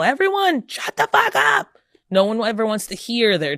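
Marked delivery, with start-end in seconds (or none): 0.0-1.7 much higher, louder mock yell; frustrated mom mock yelling at her children. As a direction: play a frustrated mom snapping at the kids. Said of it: "sometimes we yell".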